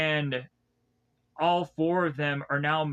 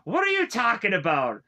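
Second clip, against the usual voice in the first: louder and higher, incredulous; loud disbelief; 6 semitones higher, 5 dB louder than usual. Real, not acted. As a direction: throw the question back, louder and higher in disbelief. Reacting to Mikasa's line.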